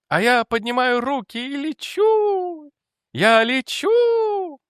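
very high, soaring, stretched-out cry; ecstatic madman. Acted. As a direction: float up high and hold the last word. Mad lieutenant imagines he is flying. (очень высоко и протяжно, в безумном восторге)

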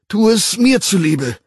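high, loud begging, stress on mir; loud plea. A narrator's reading. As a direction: push the plea harder. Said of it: "flehte Bernard lautstark".

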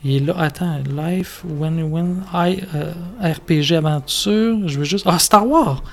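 muttering to himself. Real, not acted. Muttering to himself while browsing.

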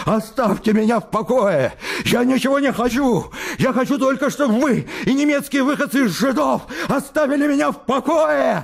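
very high, strained, screaming threats; high hysterical cry; hysterical shouting. Acted. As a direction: scream it, losing control.

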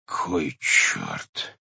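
hushed, breathy oath; muttered curse. A narrator's reading. Head discovering the sister-in-law, hushed.

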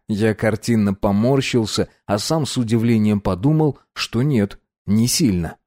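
usual speaking voice

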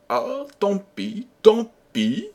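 high, drawn-out tut of regret; feigned regret. A narrator's reading. Renardino hinting bad news to the king, feigned regret. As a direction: lament it high, baiting the listener.

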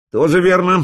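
lightly voiced cocky leader; cheerful leader voice. Farid agreeing cheerfully. (бодрый голос лидера)

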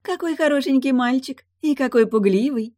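high, loud, playful mermaid voice; teasing mermaid. Acted. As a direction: tease him in a high-pitched lilt. Mermaid mocks the scared boy.

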